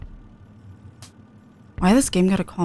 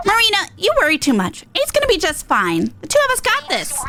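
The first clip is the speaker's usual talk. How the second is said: higher, brash little idol voice; cocky and upbeat, pitched up; Pearl voice; 11 semitones higher than usual. Acted. Streamer voicing Pearl to Eight, pitched up and cocky.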